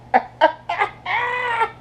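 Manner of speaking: high-pitched squealing laugh; a high giggle fit; squealing laughter. Real, not acted. Giggling at the octopus scene.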